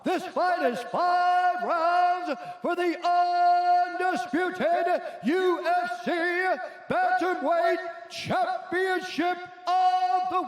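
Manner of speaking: big drawn-out fight announcer call; booming ring announcer. Acted. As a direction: boom it out like a title-fight announcer.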